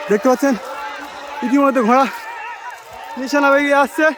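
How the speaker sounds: high, loud excited race call; race caller. Acted. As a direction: ride the rising excitement of the race. Race starts, commentator excited. (উত্তেজিত ঘোড়দৌড়ের ধারাভাষ্য)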